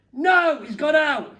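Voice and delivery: high, loud mock wife voice; hammed-up answer at the door; Santa's wife. Santa's wife answering the comedian, high and loud.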